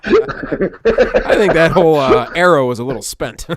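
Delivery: slow and loud, laughing through the words; laughing hard. Real, not acted. Joking with his friend about burned identity, laughing. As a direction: let the laugh take over.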